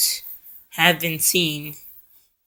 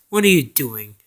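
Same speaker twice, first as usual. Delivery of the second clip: lower, firmer frog character voice; Rasputin's voice; 4 semitones lower, 3 dB louder than usual. Acted. Rasputin stops Napoleon mid-jump, low and firm character voice. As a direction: challenge him in a lightly voiced low tone. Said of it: "he goes like".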